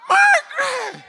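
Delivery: very high, loud cry of her name; ecstatic shout. Acted. Husband seeing his wife at the door, ecstatic shout.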